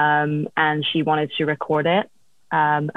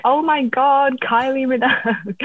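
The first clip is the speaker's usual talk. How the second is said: starstruck gasp, pitched high; excited; 9 semitones higher, 3 dB louder than usual. Retold or imitated. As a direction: squeal it like a starstruck fan. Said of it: "So I was like".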